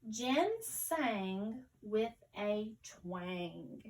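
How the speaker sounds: slow, connected sentence, each word drawn out slightly. Shown as a demonstration. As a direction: join the words up, still at a learner's slow pace. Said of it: "let's read it again more fluently".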